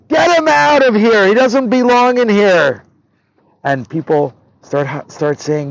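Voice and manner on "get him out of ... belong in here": furious scream. Acted. Rich stepfather spotting his slave son, furious scream. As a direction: scream it across the whole room. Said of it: "and he screams".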